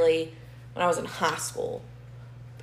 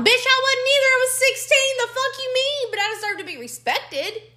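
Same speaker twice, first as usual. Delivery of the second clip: indignant outburst. Real, not acted. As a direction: fire it back, outraged and high. Indignant outburst about breakup.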